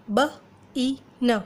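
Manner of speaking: slow, letter by letter; sounding out letters. Shown as a demonstration. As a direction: spell it out sound by sound. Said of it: "इसी तरीके से आई का ले लेते हैं".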